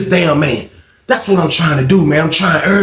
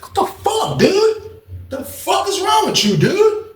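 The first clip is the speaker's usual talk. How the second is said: much higher valley-dude voice; 4 dB quieter, slower than usual. Retold or imitated.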